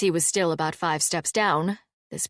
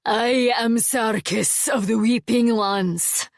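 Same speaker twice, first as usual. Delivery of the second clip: slow, louder announcement; roaring; 4 semitones higher, 5 dB louder, slower than usual. A narrator's reading. Sarkis announcing himself to frighten Alvar, roaring. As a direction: declare your name to shake the walls. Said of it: "roared the servant of the sword".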